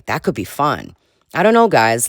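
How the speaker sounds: ordinary delivery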